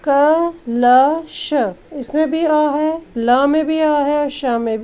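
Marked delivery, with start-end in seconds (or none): 0.1-1.5 क ल श spaced out slowly and louder; letter by letter. Shown as a demonstration. Teacher spelling the word for children, slow and loud. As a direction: sound out each letter for the class. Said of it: "splitting the word into letters".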